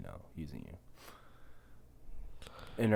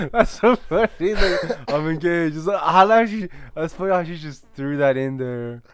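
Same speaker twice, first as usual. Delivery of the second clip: high, loud laugh spilling into the words; real laughter; 9 semitones higher, 9 dB louder than usual. Real, not acted. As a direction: laugh through it, really amused. Laughing at "I'm engaged.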